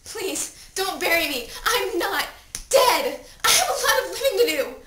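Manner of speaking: loud, high, desperate pleading; frantic begging. Acted. Dead teen begging God for another chance, frantic. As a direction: bargain for life at full pitch.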